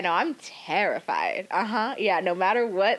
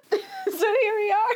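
laughing. Real, not acted. Laughing through hiccups.

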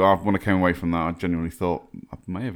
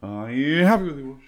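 passing by doppler; 7 semitones higher than usual. Pretend. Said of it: "Good, wasn't it?".

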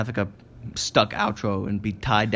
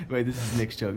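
usual speaking voice

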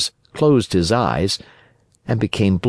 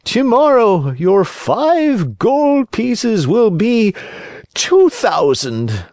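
high, slow, sly fox; sly fox warning; 4 dB louder, slower than usual. Acted. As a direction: warn him slowly, sweetly threatening. Fox tempts.

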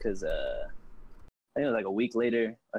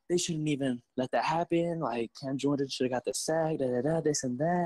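salty fan; 4 semitones higher than usual. Retold or imitated. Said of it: "the hate and the saltiness".

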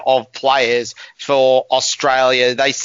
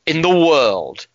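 slow, drawn-out TV presenter's sign-off; Jeremy Clarkson; much slower than usual. Acted. Host closing his cricket intro, slow presenter impression. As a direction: stretch it out with a weighty falling finish. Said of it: "channel my inner Jeremy Clarkson".

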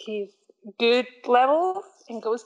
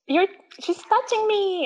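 whiny sibling; 10 semitones higher than usual. Retold or imitated. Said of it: "where he's like".

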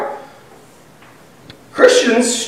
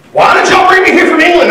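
high, louder whiny mock gripe; mock grumbling complaint; 9 semitones higher, 4 dB louder than usual. Preacher mocking his family griping about England, high whiny voice. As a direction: gripe like a descendant blaming his ancestors.

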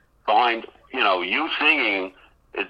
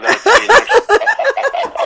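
laughing; 10 dB louder than usual. Real, not acted. Joking after name correction.

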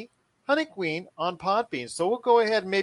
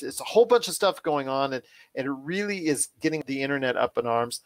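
usual speaking voice

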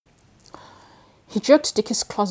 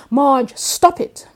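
higher, louder and slow, a sharp command; angry outburst; 7 semitones higher, 10 dB louder, much slower than usual. Acted. [name] shouting at an imagined Marge, slow and loud. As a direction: bark it at her, slow and loud.

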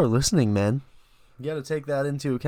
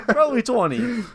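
laughing; 11 semitones higher, 5 dB louder than usual. Real, not acted. Laughing reply.

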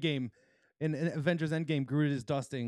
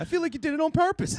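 excited; 10 semitones higher, 6 dB louder than usual. Real, not acted. Excited reaction to the scandal.

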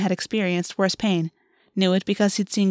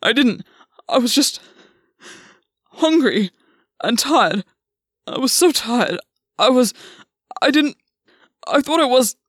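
halting, higher, louder excuses; distraught; 4 semitones higher, 5 dB louder than usual. Acted. Gwen breaks down defending himself.

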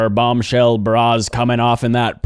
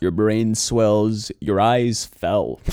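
slow mock recitation; much slower than usual. Slow mock symptom list.

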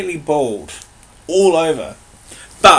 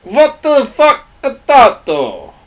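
much higher, louder, slow mock-strict barking; strict Nazi parody; 10 semitones higher, 8 dB louder, much slower than usual. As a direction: bark it slowly like a stiff drill officer. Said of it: "the strictness of a Nazi speaking".

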